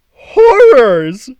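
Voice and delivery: high, loud theatrical cry of horror; horrified high-pitched shout; dramatic cry. Acted. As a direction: shriek it like a scandalized god. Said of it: "I cried".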